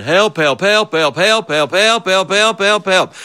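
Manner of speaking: high, frantic cries for help; yelling for help. Retold or imitated. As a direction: call out for help, rising in panic. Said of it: "And I was yelling".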